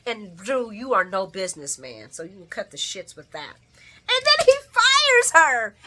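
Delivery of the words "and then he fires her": high, loud, drawn-out outrage; outraged outburst. Real, not acted. Telling viewers he fired her, loud outrage. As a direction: cry it out slowly, high and loud.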